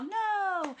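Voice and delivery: high, loud group shout; kids yelling. Retold or imitated. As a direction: yell it like a room of kids. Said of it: "And the kids yell".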